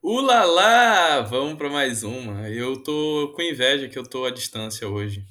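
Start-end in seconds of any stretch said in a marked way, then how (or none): 0.0-1.2 drawn-out, loud, high ulalá; excited ulalá. Real, not acted. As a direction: sing out a slow, delighted ulalá. Playful exclamation on being introduced.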